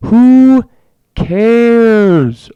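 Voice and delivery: bellowed dismissal; shouted. Real, not acted. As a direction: yell it out in exasperation. Ranting, repeats it louder.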